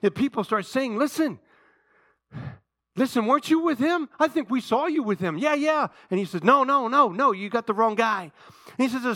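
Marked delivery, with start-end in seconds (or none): 1.0-5.9 higher, insistent mock accusation; lightly acted crowd pointing someone out; accusing bystanders. Bystanders challenging Peter about Jesus, accusing. 6.4-8.3 higher, louder mock protest; hasty denial. Peter denying to bystanders that he knows Jesus, protesting.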